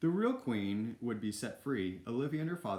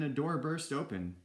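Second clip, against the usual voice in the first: plain reading voice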